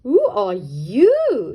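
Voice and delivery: loud, slow cross demand; cross shriek. Acted. Duck Dora to rabbit, slow loud shriek. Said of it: "shrieked Dora crossly".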